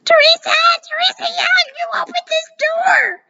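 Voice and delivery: mother shrieking through the door; screaming mother. A narrator's reading. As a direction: scream her name from outside the door, frantic. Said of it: "terrible pounding on the door and screaming".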